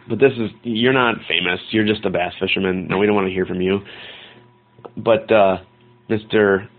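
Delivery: usual speaking voice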